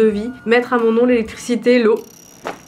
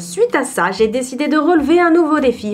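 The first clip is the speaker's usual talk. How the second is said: voice-over read; 3 semitones higher than usual. Acted. Records her intro voice-over.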